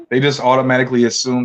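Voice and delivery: ordinary talk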